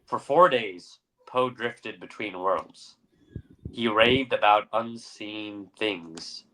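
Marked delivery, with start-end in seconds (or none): none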